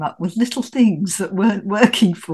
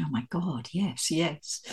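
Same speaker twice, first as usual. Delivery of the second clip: delighted murmur; 4 semitones lower, 11 dB quieter, slower than usual. Retold or imitated. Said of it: "And I'm going".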